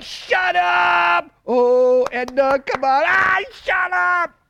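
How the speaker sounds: high, loud mock yell of a furious kid; imitating the furious youngest brother; little brother's tantrum. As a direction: scream it like the teased little brother losing it. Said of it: "He'd be like".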